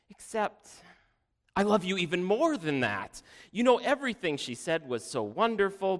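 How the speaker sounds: high-pitched fawning, stumbling for words; gushing false humility. A narrator's reading. Second daughter outdoing her sister's flattery, gushing. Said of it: "she gushed with only thinly veiled humility".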